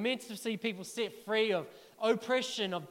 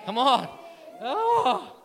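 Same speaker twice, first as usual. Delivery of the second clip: fired up; 4 semitones higher, 8 dB louder, much slower than usual. Real, not acted. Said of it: "I'll get way more fired up".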